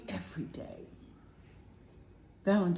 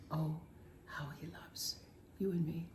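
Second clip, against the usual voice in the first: hushed hymn line; 3 semitones lower, 10 dB quieter than usual. Real, not acted. Quoting the hymn lyric softly.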